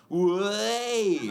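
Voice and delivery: high, loud mock "Way" cheer; a ghostly British woo from the audience; British crowd woo. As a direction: imitate a limp crowd woo. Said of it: "They go".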